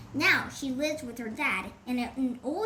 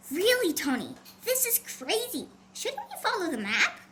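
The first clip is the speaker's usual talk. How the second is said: excited; 6 semitones higher than usual. Acted. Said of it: "Carmen shouted".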